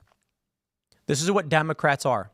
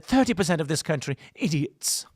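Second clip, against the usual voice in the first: Milo impersonation. Retold or imitated. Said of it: "That's my Milo impersonation".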